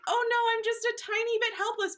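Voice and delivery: high, airy damsel voice; a high put-on damsel sigh; mock helpless. Mimics helpless persona.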